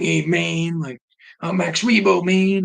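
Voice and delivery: high, loud jive-talking jazz cat; seventies jazz guy. Retold or imitated. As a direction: ham up a hip seventies jazz man. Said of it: "like a seventies jazz".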